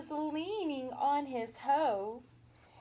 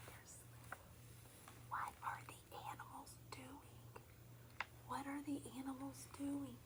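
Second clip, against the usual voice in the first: whisper; 17 dB quieter, much faster than usual. Real, not acted. Animals asleep, hushing the child.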